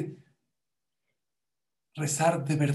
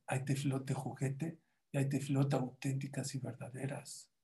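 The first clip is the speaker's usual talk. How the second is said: soft, serious lesson voice; teacher turns serious to his pupils, much softer; quiet earnest teacher; 8 dB quieter than usual. Retold or imitated. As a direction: drop down and bring the point home gently. Teacher delivers moral softly.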